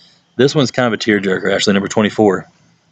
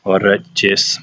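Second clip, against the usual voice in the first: put-on lightly Russian-accented teacher; a hint of a stern Russian teacher; a touch of a gruff Russian accent; Russian teacher impression. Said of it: "And you go".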